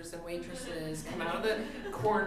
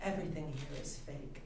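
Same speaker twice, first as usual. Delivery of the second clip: put-on softer, low and gentle to a toddler; waitress comforting a crying child, gently; 3 semitones lower, 11 dB quieter than usual. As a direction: soothe a four-year-old eye to eye, softly. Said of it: "looked at her and said".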